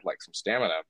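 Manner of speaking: ordinary talk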